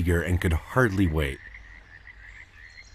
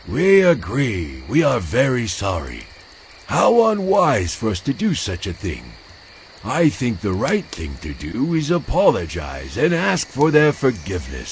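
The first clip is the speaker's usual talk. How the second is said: put-on wise old turtle; 3 semitones higher, 4 dB louder than usual. Rama the wise turtle apologizing, remorseful. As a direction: speak as the remorseful elder. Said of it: "said Rama, the wisest turtle".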